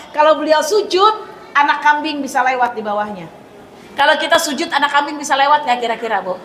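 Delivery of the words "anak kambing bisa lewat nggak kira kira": normal talking voice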